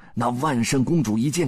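usual speaking voice

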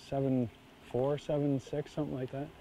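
normal talking voice

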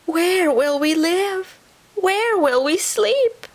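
very high, slow, plaintive little voice; young possums crying out. A narrator's reading. Young possums to Papa after the flood, crying out. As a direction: draw out each worried question up high. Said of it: "cried the young possums".

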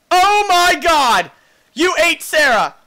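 loud high outraged shock; shocked outcry. Real, not acted. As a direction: scream it in disbelief. Shock at pet eaten.

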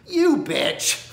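high sudden "You bitch"; high mocking jab; yelled insult. Real, not acted. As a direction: spit it out in a high burst. Outburst at studio.

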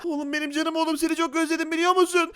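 high, softened emotional voice; hammed-up tearful fondness; mock-tender father. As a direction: coo to your rescued child. Father reunited with son.

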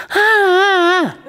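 high, loud mock crying yelps; hammed-up squeals of a pinned kid; imitated pained wail. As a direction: imitate the bully wailing in pain. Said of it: "He was going".

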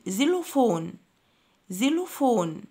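"زيلوفون" starting with a clear z sound. Shown as a demonstration. Teacher models xylophone for pupils, X said as Z. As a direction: say "زيلوفون" with z for the letter X. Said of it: "بننطقه زد".

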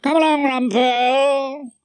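high, sustained hail; calling out. A narrator's reading. Host summoning Rumpole to the table, calling out. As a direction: call him over from across the room. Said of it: "Featherstone called to me".

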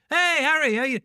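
high, loud chummy hello; best-mate greeting to a stranger; overfamiliar greeting. Retold or imitated. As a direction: greet him like an old friend. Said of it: "like you would say to your best mate".

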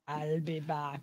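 low, soft action-hero catchphrase voice; Arnold. Acted. Viewer asks for the impression, low action-hero voice. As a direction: drop low for a mock Austrian-accented hero. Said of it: "[name], do Arnold".

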